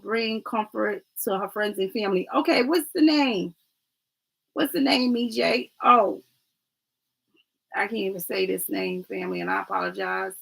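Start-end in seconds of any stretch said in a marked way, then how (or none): none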